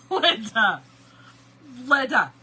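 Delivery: loud, falling calls of the name; hollering. Retold or imitated. Imitating the hollering in the store, loud. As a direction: holler the name, a pause after each. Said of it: "she was hollering".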